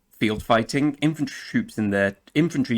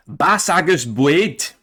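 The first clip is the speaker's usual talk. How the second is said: put-on slow, high, louder Gaelic name in Scottish accent; 6 semitones higher, 7 dB louder, slower than usual. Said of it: "someone will correct me in the comments".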